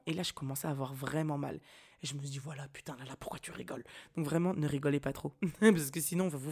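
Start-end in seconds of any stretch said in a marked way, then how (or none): 2.4-3.8 soft, lower, scolding herself under breath; muttered self-scolding. Retold or imitated. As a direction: grumble at yourself quietly.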